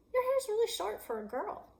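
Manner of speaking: high mocking tease; teasing boy. Boy teasing the girl about her short hair, high and mocking.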